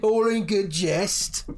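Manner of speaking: very high, loud placating voice; backing-off drunk. Acted. Drunk backing off from the warrior, very high and placating. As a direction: hands up, laughing it off. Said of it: "he's like".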